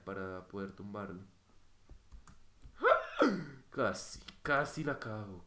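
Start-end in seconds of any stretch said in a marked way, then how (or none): 1.9-3.6 loud, high gasp of fright; sharp startled gasp. Real, not acted. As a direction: gasp at a near disaster. Nearly blunders in the game. (jadeo de susto)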